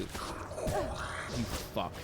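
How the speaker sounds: breathy whispered "You fuck" under the breath; whispered curse. Real, not acted. Gamer whispering a curse at the enemies. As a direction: hiss "You fuck" at the screen.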